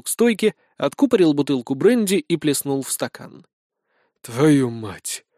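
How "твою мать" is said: low, muttered curse; grumbled curse. Acted. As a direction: mutter it into the glass. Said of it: "буркнул он".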